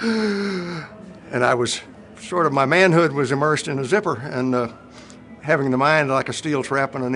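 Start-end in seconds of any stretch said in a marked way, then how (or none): 0.0-2.5 sharp high gasp of pain, then slow words; caught-short gasp, words dragged out slowly. Retold or imitated. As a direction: gasp as the zipper catches. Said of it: "and went".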